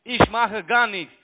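loud, slow, drawn-out denial; loud protest. Real, not acted. Scammer loudly insists to the caller he does nothing. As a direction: bellow your innocence. Scammer shouting his innocence.

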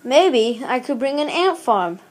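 ordinary delivery

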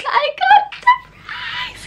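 tiny, very high squeal; thin, drawn-out squeal of delight; excited squeal. Real, not acted. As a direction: squeal it slowly at the top of your voice's range. Repeating the surprise in a squeal.

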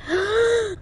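sharp high gasp; startled gasp. Real, not acted. Player startled by a creature, gasping. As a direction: gasp in sudden fright.

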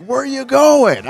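calling after. Retold or imitated. Friend chases him down, calling after him. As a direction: call out to a friend walking away.

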